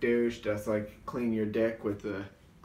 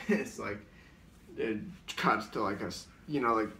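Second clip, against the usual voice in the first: ordinary talk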